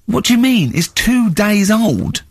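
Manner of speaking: high, slow disbelieving reply; exasperated retort. Retold or imitated. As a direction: spell it out slowly in disbelief. [name] quotes his own exasperation.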